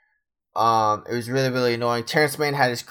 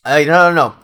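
loud, higher burst of no, no, no; excited no; 5 semitones higher, 11 dB louder than usual. Real, not acted. Podcaster stopping himself before Jazz stats, loud and excited. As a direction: stop yourself short, loud and keyed up. Catches himself forgetting Beverley.

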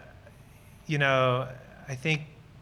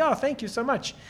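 higher, gushing thank you; eager thanks; 8 semitones higher than usual. Retold or imitated. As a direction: gladly accept the free lunch. Accepting lunch eagerly, retold.

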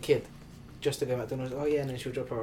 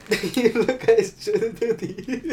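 laughing; 7 semitones higher, 6 dB louder, slower than usual. Real, not acted. Laughing at friend's username.

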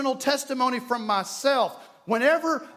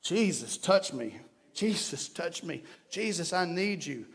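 hushed, pleading prayer, fading lower; soft prayer; 5 semitones lower, 7 dB quieter than usual. Retold or imitated. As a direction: murmur it like a private plea.

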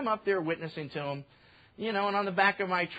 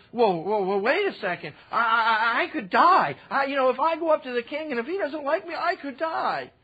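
higher, louder fearful protest; Esther's alarm; 6 semitones higher, 5 dB louder than usual. Retold or imitated. Esther afraid to approach the king, fearful protest. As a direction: back away in alarm.

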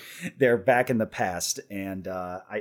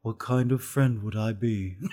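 Dream voice. Acted. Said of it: "that's my Dream voice".

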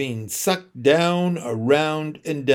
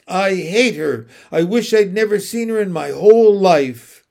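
put-on a grieving boy sobbing to his father; through sobs; 4 semitones higher, 7 dB louder than usual. A narrator's reading. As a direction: force the words out between sobs. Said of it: "Jess said through his sobs".

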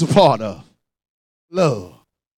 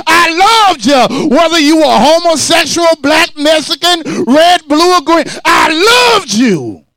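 Jesus preaching; 5 semitones higher, 3 dB louder than usual. Acted. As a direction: proclaim His love from the cross, shouting. Pastor voices Jesus on the cross.

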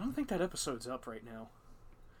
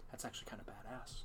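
fast, whispered; quick impressed whisper; whispered aside; 5 semitones lower, 12 dB quieter, faster than usual. Retold or imitated. Jordan's quiet verdict retold.

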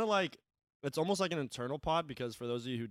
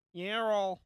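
mock prim teacher saying "Urinal", higher; imitating older teacher; 7 semitones higher, 4 dB louder, slower than usual. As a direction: do a fussy older female teacher. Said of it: "like, female teachers".

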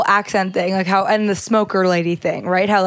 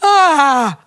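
a loud mock yawn, pitched up; imitated yawn; 6 semitones higher, 6 dB louder than usual. Explaining contagious yawns, a loud mock yawn. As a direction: let out a big fake yawn. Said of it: "your body responds by going".

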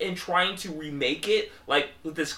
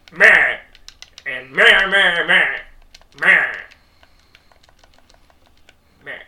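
mocking blah blah; 9 dB louder, slower than usual. Real, not acted. Mocking dismissive meh.

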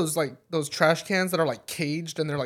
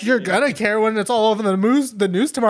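mock threat; 5 semitones higher, 7 dB louder than usual. Lady threatening.

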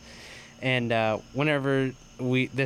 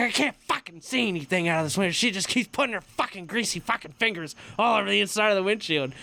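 retold angry rant; 6 semitones higher than usual. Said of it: "He's like".

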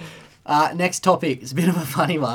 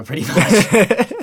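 laughing; 6 semitones higher, 6 dB louder, much slower than usual. Real, not acted. Laughing at own despair.

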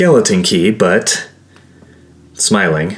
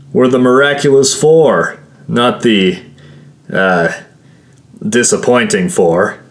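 higher, lightly voiced joker; joking comrade; 5 semitones higher, 3 dB louder than usual. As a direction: announce it grandly, then fumble for the word. Doran jokes in character dialogue.

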